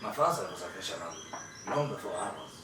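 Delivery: lightly voiced old fisherman, mild and halting. Old fisherman answers travellers about his river, halting and mild. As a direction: play a gently rambling old river fisherman.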